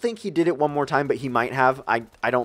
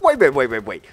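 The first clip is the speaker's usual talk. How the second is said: rapid-fire, run-together waits; urgent calling back; 4 dB louder than usual. Retold or imitated. To a friend walking off, hurried urgent protest. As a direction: rattle it off to stop someone leaving.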